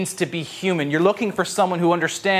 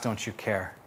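softer, lower, almost to yourself; quiet hurt question; 7 semitones lower, 12 dB quieter than usual. Retold or imitated. Listener quietly asking God, soft and weary. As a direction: let it fall away, small and tired. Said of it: "maybe you ask".

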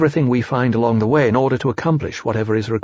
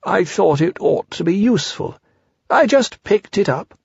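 Pooh Bear; 7 semitones higher than usual. A narrator's reading. Pooh's voice in story.

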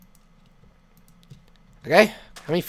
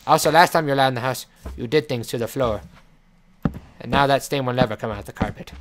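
ordinary delivery

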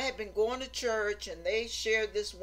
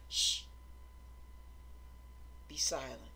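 soft, low shushing command; hushing; 8 semitones lower, 9 dB quieter than usual. Acted. God hushing someone eager to yap, soft low hush. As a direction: hush them, then quietly order silence. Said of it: "for God to say".

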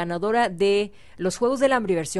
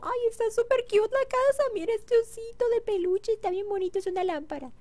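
high, cooing admiration of a cute house; cutesy high voice; 12 semitones higher, 4 dB quieter than usual. Acted. Gamer admiring a Sims house, in a high cooing voice. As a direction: coo over the little house like a child.

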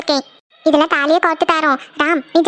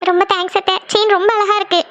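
mildly tearful, unsmiling gratitude; tearful thanks; 3 semitones higher than usual. Acted. Daughter-in-law thanking her aunt for the chain, tearful. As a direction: say thanks while still close to crying. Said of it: "அதை கொஞ்சம் சிரிச்சுட்டே தான் சொல்லம்மா".

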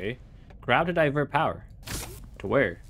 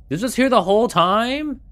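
loud, high disbelief; exasperated surprise; 8 semitones higher, 6 dB louder than usual. Real, not acted. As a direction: shout it out in disbelief. Discovering he missed something.

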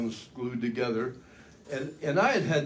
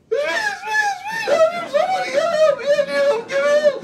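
terrified pilot shrieking into the radio; panicked high shriek; 7 dB louder, much slower than usual. Retold or imitated. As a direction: voice shot way up, screaming for help. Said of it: "my voice was up about three octaves".

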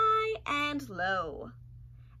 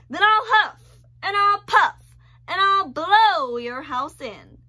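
loud, high, slow furious wolf; screaming wolf; 7 semitones higher, 12 dB louder than usual. Acted. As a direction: scream each threat. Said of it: "screamed the wolf".

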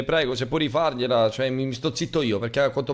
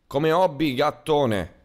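ordinary talk, nothing marked

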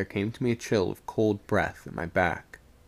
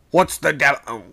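raised, startled voice; alarmed shout; 4 semitones higher, 7 dB louder than usual. A narrator's reading. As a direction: call out sharply in alarm. Second mate alarmed shout.

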